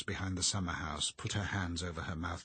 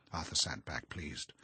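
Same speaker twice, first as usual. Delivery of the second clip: usual speaking voice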